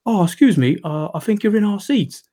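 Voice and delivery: a hint of polite Bridgerton-style English accent; posh English accent. Posh cinema couple claiming his seat, mock posh English accent. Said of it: "to talk like Bridgerton".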